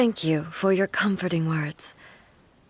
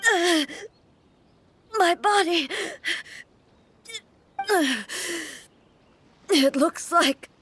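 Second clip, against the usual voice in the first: higher, halting words forced through pain; strained with pain; 4 semitones higher than usual. Acted. Archon telling Paimon she must reach the cave, strained and pained. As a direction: push each word out through the hurt.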